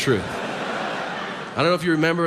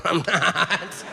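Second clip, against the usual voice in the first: laughing denial; 3 semitones higher than usual. Retold or imitated.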